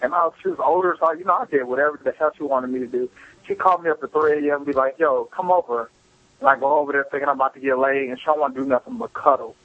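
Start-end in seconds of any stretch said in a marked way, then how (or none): none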